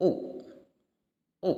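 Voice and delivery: "U" with rounded lips pushed forward; vowel u demo. Shown as a demonstration. Teacher showing students the rounded vowel, lips forward.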